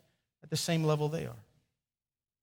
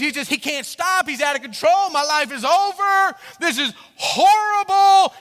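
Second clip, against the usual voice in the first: building higher and louder to a wail; mounting mock despair; 10 semitones higher, 9 dB louder than usual. Father voicing son's melodrama, building from loud to wailing. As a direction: pile it on till horrible wails.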